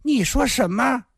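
high-pitched indignant outcry; loud outburst. A narrator's reading. Fat woman stunned by a rival's claim, crying out high. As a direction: burst out in disbelief, pitch leaping up. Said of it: "大声地说".

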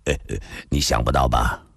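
low, rueful half-laugh before speaking; resigned, mirthless little laugh; wry bitter chuckle. Acted. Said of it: "龙啸云苦笑地说道".

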